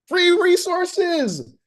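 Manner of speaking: high, slow, drawn-out "Free resources"; thrilled. Real, not acted. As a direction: savour every word of the freebie. Excited about free templates.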